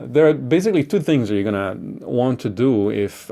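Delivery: ordinary talk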